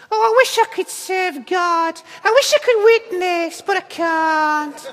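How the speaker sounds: put-on high, doleful puppet voice, northern accent; squeaky wishing that slows into a drawn-out refusal; Orville. Self-doubting churchgoer as puppet bird, high mournful northern voice. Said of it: "there is Orville syndrome in".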